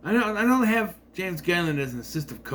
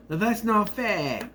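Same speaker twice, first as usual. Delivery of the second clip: slow, higher put-on Kiwi accent; lightly imitated New Zealand accent; mock New Zealand accent; 7 semitones higher, slower than usual. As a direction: drag out "fair" in a rough New Zealand accent. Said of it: "I don't know how New Zealand accent".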